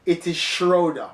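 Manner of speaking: "Schroeder" said SHROH-duh, with a long OH; firm correction, "Schroeder" as SHROH-duh; correct pronunciation. Shown as a demonstration. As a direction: land on the OH in "Schroeder". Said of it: "just pronounce the man's name correctly".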